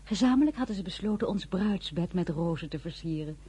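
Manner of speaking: normal talking voice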